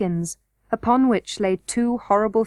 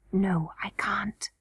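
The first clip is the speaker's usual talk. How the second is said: hushed reply; 7 dB quieter, slower than usual. A narrator's reading. Trapped Curdie admits he cannot get out, whispering. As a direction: whisper it slowly.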